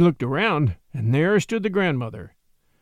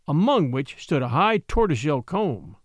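usual speaking voice

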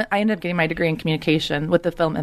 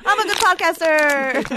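laughing; 7 semitones higher, slower than usual. Real, not acted. Joking after ice crunch.

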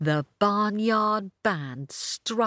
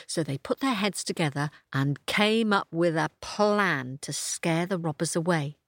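ordinary delivery, nothing marked

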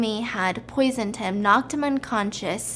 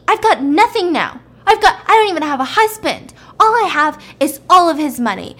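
loud, heated tirade; angry retort; 5 semitones higher, 7 dB louder than usual. Retold or imitated. Woman refusing the cop's order to kill, loud angry tirade. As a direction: argue back at full voice, indignant. Simi fights back at cop.

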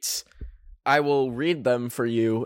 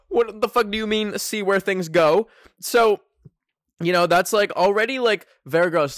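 disgusted outrage; 7 semitones higher, 3 dB louder than usual. Real, not acted. Outraged by the creepy message.

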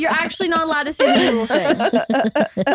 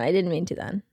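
repeated much softer and lower; quieter repeat; 7 semitones lower, 6 dB quieter than usual. Real, not acted. Younger sister repeating to mom, softer and mumbled. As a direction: mumble it under your breath. Repeats it after being told off.

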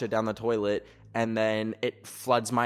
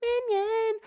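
very high squeaky cartoon voice; Minion. Dancing in front of the giant figure, squeaky cartoon voice. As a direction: chirp it as a tiny cartoon creature. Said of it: "be like".

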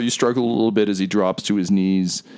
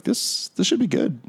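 talking shield, lifted above the narration; a lightly voiced shield looking on; 10 semitones higher than usual. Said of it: "the shield's like".

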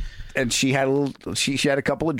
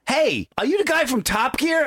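fan's excited question; 11 semitones higher than usual. Retold or imitated. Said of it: "I didn't get the sentence out".

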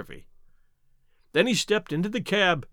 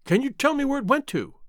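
high, urgent plea; emotional cry; 8 semitones higher than usual. A narrator's reading. Ryder begging to know where the goose went, emotional cry. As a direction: plead eagerly, pitched right up. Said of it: "Ryder quivered with emotion".

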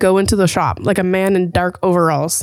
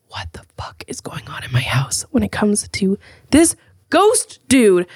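hushed whisper about the ghost; spooky whisper. Real, not acted. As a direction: drop to a whisper for the ghost talk. Talking about ghost in house.

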